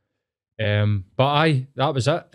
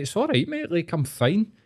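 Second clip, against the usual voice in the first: much higher, light and dismissive; breezy reassurance; 7 semitones higher than usual. Retold or imitated. Assuring his driving instructor he's fine, much higher and breezy. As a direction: wave it away in a lifted voice. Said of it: "And I was like".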